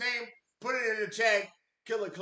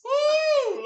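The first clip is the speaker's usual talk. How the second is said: anguished woo; 9 dB louder than usual. Real, not acted. Reacting to the loss.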